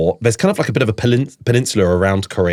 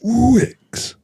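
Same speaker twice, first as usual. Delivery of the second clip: actor in booth; 13 semitones higher than usual. Pretend. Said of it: "Can we go a bit lower".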